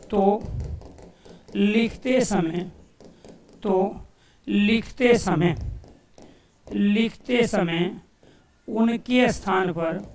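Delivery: slow, word-by-word dictation with long pauses. Real, not acted. Teacher writing out the definition for students, slow dictation. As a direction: dictate it piece by piece, repeating slowly.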